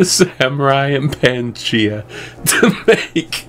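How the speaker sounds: laughing. Real, not acted. Laughs at crafting Japan.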